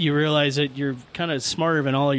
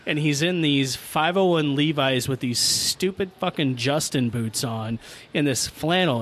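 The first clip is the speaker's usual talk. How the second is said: usual speaking voice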